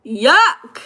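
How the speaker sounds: mock disgust. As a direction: spit out the Yuck like rotten food. Disgust at rotten food picture.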